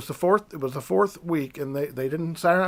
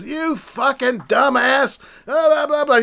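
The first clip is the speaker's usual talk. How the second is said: retold yelling; 8 semitones higher, 4 dB louder, slower than usual. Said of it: "he was yelling at an employee".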